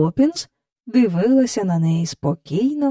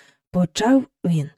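plain reading voice

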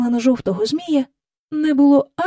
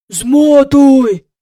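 very loud, raised, drawn-out order; hissed order; 4 semitones higher, 11 dB louder than usual. A narrator's reading. Park keeper ordering Michael to wind the string, hissed loudly. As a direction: order it forcefully, stretching the word. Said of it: "просичав доглядач".

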